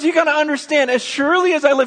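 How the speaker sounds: God swearing to his people, loud and high; God pleading. Retold or imitated. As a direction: speak as God, insisting passionately. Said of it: "God goes".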